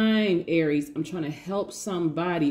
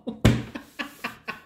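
breathy laugh; 6 semitones higher than usual. Real, not acted. Laughs at own joke.

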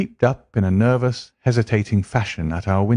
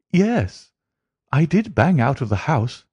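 high, earnest voice of a worried client; Mary begging Holmes to find her fiancé, high and earnest; pleading young woman; 5 semitones higher than usual. Acted. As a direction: beg the detective for help. Mary explains hurry.

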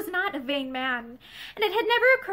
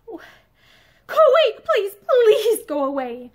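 sobbing anguish; 6 semitones higher, 8 dB louder than usual. Acted. Pansy sobbing at Ramsden to go away in anguish. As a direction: sob it out, begging him to leave. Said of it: "she sobbed out in broken anguish".

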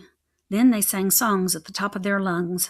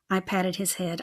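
plain reading voice

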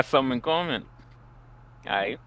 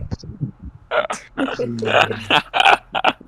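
breathy laughing fit, a bit louder; real burst of laughter; 4 semitones higher, 6 dB louder than usual. Real, not acted. Host laughs after "no jokes.

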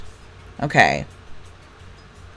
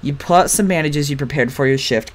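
normal talking voice

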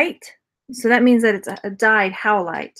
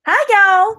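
put-on Texas accent; 10 semitones higher, 6 dB louder than usual. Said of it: "my accent I'm doing right now is not a Texas accent".

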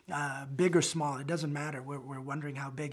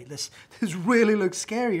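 loud, higher nervous laugh; mock alarm; 5 semitones higher, 12 dB louder than usual. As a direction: play up the worry, half laughing. Acting out alarm at tents.

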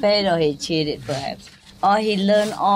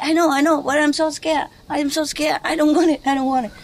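higher, pleading, hammed-up fear; a lightly acted scared patient begging his friend; mock scared patient; 6 semitones higher, faster than usual. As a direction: whine it like a frightened patient. Retold scared patient in joke.

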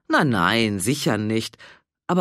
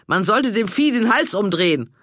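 loud, angry snap; 5 semitones higher, 5 dB louder than usual. A narrator's reading. Renate glares at the dog owners, loud and angry. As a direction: spit it out in fury. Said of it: "und stapfte zornig weiter".

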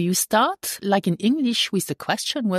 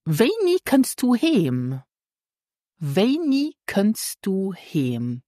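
slow, higher teaching delivery; slow model question; 4 semitones higher, slower than usual. Shown as a demonstration. Teacher models a question for learners, slow and careful. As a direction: measure out "Wenig kannst du heim" word by word.

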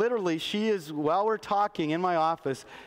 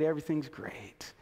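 whispered, softer, ironic everything's great; whispered ironic aside; 6 semitones lower, 7 dB quieter than usual. Retold or imitated. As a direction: breathe it like a hollow secret. Woman mocks church's fake cheer.

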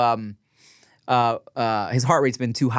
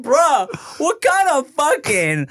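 incredulous laughing; 12 semitones higher, slower than usual. Retold or imitated. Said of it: "And I was like".

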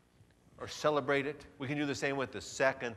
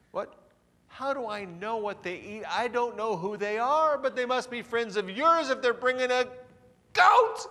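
exasperated protest; 10 semitones higher, 5 dB louder than usual. Acted. Partner protests.

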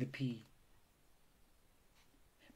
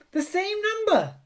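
very high, raised squeal of discovery; high-pitched eureka cry; excited shout; 6 dB louder than usual. A narrator's reading. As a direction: shout it out, thrilled. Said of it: "shouted Spinderella".

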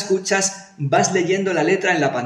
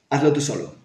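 ordinary delivery

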